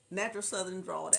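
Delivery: ordinary talk, nothing marked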